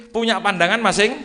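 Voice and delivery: ordinary delivery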